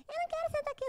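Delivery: high, whiny refusal; girl refuses the teacher's order, high and bratty; defiant girl. Retold or imitated. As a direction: whine it back at the teacher.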